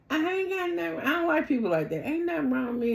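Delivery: high-pitched retold denial; mock defensive denial. Acting out woman's defensive denial.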